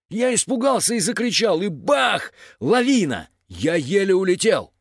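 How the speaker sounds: high, animated storytelling of a fright; excited retelling. A narrator's reading. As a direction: act out the scare with big emphasis. Зер взволнованно рассказывает о лавине. (взволнованный рассказ)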